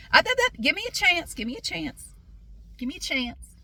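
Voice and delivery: high-pitched, interrupting imitation; pleading salesman. Salesman begging customer for a chance, mock pleading. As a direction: cut in high, then ease off as you plead.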